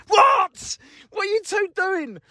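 egging them on in mock frustration; exasperated. Real, not acted. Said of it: "cringing of embarrassment".

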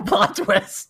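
laughing through the words. Real, not acted. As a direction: giggle it out. Cracking up at silly twist.

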